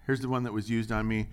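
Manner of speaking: ordinary talk, nothing marked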